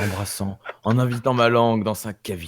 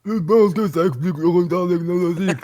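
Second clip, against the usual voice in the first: fast, higher mimicry of the alien; mocking echo; 6 semitones higher, 3 dB louder, faster than usual. Player parroting the game master's alien line, mocking. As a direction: parrot it back in a silly alien voice.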